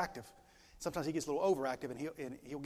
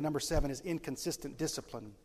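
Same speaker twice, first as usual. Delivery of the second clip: ordinary talk, nothing marked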